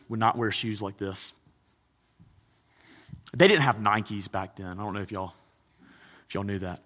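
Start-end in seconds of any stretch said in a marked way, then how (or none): 3.4-6.8 low, understated sarcasm; dry aside, dropping softer at the end; sarcastic. Real, not acted. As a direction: state the obvious with quiet sarcasm. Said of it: "sorry for the sarcasm".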